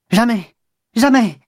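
sharp high cry of refusal, twice; the mother's outcry, voiced high and loud; retold shout. Suzanne voicing her mother's shouted refusal, high cry. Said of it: "Puis elle a crié".

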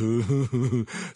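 stifled giggle; suppressed giggles. Acted. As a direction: giggle behind a hand. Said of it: "en pouffant de rire".

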